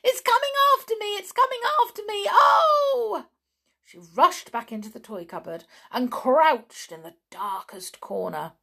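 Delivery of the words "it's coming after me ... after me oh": very high, loud, racing panic; terrified scream. A narrator's reading. Amelia Jane flees the jumping beetle, terrified scream. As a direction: shriek it fast, ending in a loud wail. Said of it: "Amelia Jane screamed".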